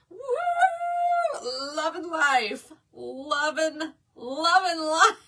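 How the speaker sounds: high, whooping imitation of joy; mock thrilled riders. Imitates thrilled riders behind her.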